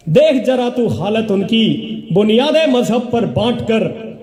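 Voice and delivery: measured, rhythmic reciting of verse; stage poetry reading; poem recitation. Acted. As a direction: declaim each line to the audience, letting it fall. Recites poem, repeating lines.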